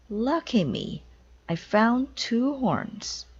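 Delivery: high, slow, gleeful; delighted. Acted. Amelia finding two horns, slow gleeful delight. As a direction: gloat happily over the find.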